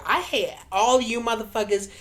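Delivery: imitation of a flamboyant man ranting; flamboyant dude. Flamboyant dude telling off everyone in the laundromat, mock ranting. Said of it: "flamboyant dude came in".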